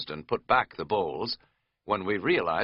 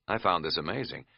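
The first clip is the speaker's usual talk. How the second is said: usual speaking voice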